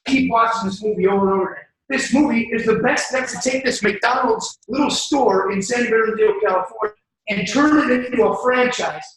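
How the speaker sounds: loud, driving motivational preacher; preaching at full force. Acted. Rallying the crowd about the McDonald's movie, loud preaching. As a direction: shout it out to the whole room like a revival.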